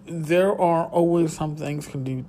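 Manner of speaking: usual speaking voice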